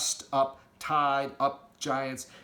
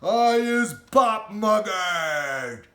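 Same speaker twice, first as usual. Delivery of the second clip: very slow, higher, loud screech; screeching giant; 6 semitones higher, 10 dB louder, much slower than usual. Acted. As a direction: screech the last protest long. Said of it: "screeched the butcher boy".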